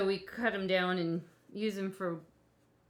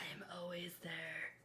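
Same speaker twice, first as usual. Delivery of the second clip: eerie breathy whisper; 11 dB quieter than usual. Pretend. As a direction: whisper it like a ghost. Spooky whisper joke.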